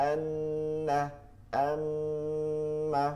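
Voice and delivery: আন্না and আম্মা with a long held nasal hum; nasal ghunnah. Shown as a demonstration. Teacher showing students a drawn-out nasal ghunnah. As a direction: hold the nn and mm through the nose, slowly. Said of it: "নাকের বাসি থেকে গুন্নাহ উচ্চারিত হয়".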